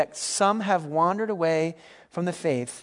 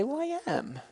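high, soft mock protest; protesting listener; 11 semitones higher, 5 dB quieter than usual. Congregant answering a call to be grateful, mock protesting. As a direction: pitch it way up as the defensive listener. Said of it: "many of us would say".

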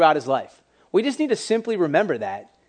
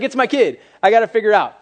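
loud, high imitation of a worried mother; mock distress; 6 semitones higher, 8 dB louder than usual. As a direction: act out Mary's alarm, pitched up and louder. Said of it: "they're in great distress".

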